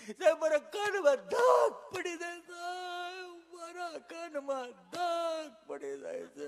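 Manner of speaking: high, loud, slow howling sob; hammed-up despairing wail; mock shout-cry. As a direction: bawl it out, stretched and broken. Patient's climax, shouting and crying.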